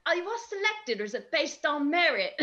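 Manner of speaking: a playful attempt at a Welsh teammate's voice; a hint of Welsh, said laughing; Welsh accent. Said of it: "that was a attempt at a Welsh accent".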